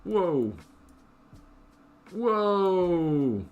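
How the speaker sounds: long, high, loud drawn-out whoa; startled by what pops up on screen; a real gasp of surprise; surprised whoa. Real, not acted. Game build goes wrong.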